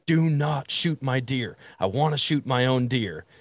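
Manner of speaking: put-on bossy kid laying down the law; a mild mock yell at his parents; retold yell. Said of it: "I yelled at both my parents".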